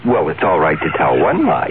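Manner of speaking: normal talking voice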